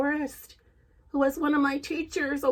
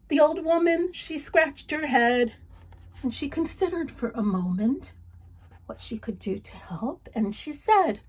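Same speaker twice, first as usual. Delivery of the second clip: story narration; 4 semitones higher than usual. Acted. Reading a folk tale to children, lively narration. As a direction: tell it with energy to a child.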